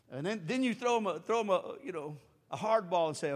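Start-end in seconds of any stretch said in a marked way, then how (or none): none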